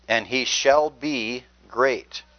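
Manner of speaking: shall drawn out slowly and leaned on hard; stress on shall be. Shown as a demonstration. Preacher stressing a prophecy to the congregation, slow emphasis on shall be. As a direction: stretch and weigh the words shall be. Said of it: "I emphasize the word shall be".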